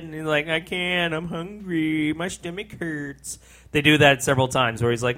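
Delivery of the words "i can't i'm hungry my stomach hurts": put-on soft whiny kid, trailing quiet. Retelling the hungry kid's complaint, soft whine.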